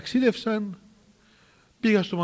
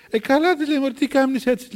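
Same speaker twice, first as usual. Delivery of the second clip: high-pitched retold exclamation; mock-surprised scolding; teasing rebuke; 7 semitones higher than usual. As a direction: chide the worrier in a raised pitch. Elder teasing the anxious nun.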